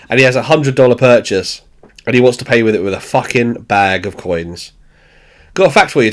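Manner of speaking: usual speaking voice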